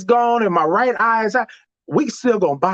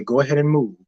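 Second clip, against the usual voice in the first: inner voice; 5 semitones lower, faster than usual. Retold or imitated. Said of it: "Myself say".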